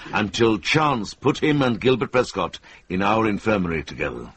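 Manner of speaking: usual speaking voice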